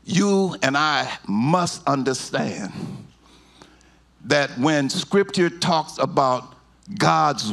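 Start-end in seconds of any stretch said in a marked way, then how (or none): none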